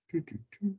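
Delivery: ordinary talk, nothing marked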